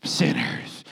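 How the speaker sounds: whispered, sneering sinners; whispered mock scorn. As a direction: hiss it like a sour hypocrite. Mock sour hypocrite.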